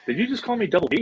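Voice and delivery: imitated movie star, high mock disbelief; Vince Vaughn. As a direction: challenge it in a put-on high voice. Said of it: "just like he's in the movie".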